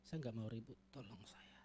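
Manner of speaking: soft, low, fading whisper to God; whispered inner prayer. Retold or imitated. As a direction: pray silently under the breath. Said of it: "Dalam hati saya".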